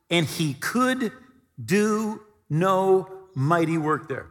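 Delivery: slow, could drawn out and leaned on; stress on could. Shown as a demonstration. Preacher quoting Mark to his church, slow weight on could. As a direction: land hard on could, slowly. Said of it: "It doesn't say Jesus wouldn't".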